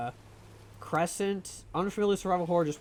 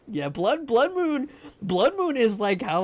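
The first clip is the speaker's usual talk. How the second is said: usual speaking voice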